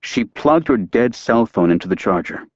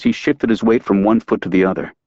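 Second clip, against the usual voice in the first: ordinary delivery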